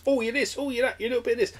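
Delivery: high-pitched mocking voice; mock mongrel teasing. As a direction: put on a high, teasing list of origins. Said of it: "made it sound like I was a proper mongrel".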